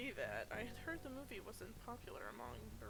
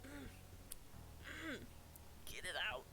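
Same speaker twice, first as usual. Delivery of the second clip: strained, thumping chest for burp; grunting while thumping own chest. Real, not acted. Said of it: "I'm hitting my chest right now".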